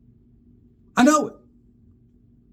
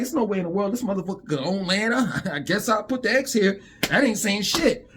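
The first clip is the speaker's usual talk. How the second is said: scoffing quote; 6 semitones lower than usual. Retold or imitated.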